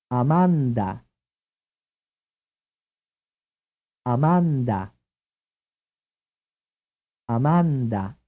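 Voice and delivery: Amanda with dental d, every vowel "ah"; ah-MAHN-dah, tongue on teeth for the d; Italian pronunciation. Shown as a demonstration. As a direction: model Amanda the Italian way for repeating. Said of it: "consonant in question is dental".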